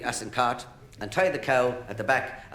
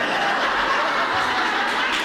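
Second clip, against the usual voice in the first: laughing; 5 dB louder than usual. Real, not acted. Comedian laughs after punchline.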